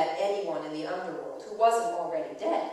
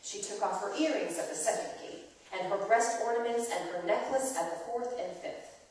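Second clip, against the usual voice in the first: ordinary delivery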